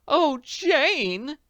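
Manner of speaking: very high, loud alarmed cry. Acted. Potter to Jane over the bag, alarmed cry. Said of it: "she cried in alarm".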